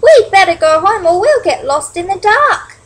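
high, loud anxious chirp; worried urging. Acted. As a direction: urge them home in alarm. Worried young bird urges the others home.

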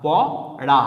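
separate, stretched প, then রা; syllables drawn separately. Shown as a demonstration. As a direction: break it into প, pause, রা. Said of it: "এটাকে আলাদা করে টানতে হবে".

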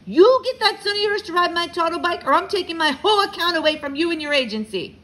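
high, loud impatient threat; angry holler. A narrator's reading. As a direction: holler an ultimatum, out of patience. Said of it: "he hollered".

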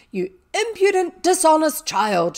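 high, loud, outraged schoolmistress; furious telling-off, acted out; angry scolding. A narrator's reading. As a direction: berate the child at full voice. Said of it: "Miss Minchin's angry voice".

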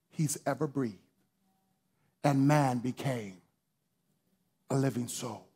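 soft, low, intimate sermon voice; hushed preaching. Acted. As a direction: draw them in close and quiet.

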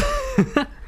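high burst of laughter. Real, not acted. Laughs at failed attempt.

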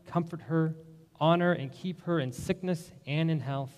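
ordinary delivery

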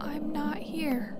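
slow breathy whisper. Real, not acted. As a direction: whisper it so nothing hears. Hiding, whispering to self.

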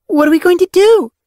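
very high, loud urgent question; demanding. A narrator's reading. Apprentice presses senior warriors for a plan, demanding. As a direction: demand an answer, high and forceful. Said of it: "Rowanpaw demanded".

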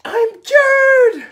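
put-on high, loud child's cry; joyful shout. Camilla celebrating her cure, high loud shout. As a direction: shout it out in delight. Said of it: "she shouted".

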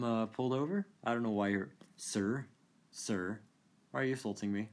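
usual speaking voice

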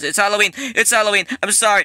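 high, loud panicked correction; frantic shouting. Pretend. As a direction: shout it out in a fluster. Shouts hasty correction to attacker.

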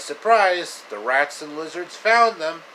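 spring the twist on the audience; dramatic surprise. Real, not acted.

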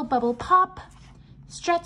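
ordinary delivery

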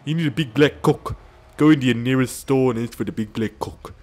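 loud, high put-on Australian-British accent; mock Australian-British accent. Mimicking the accent after Australian remark.

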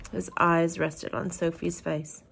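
usual speaking voice

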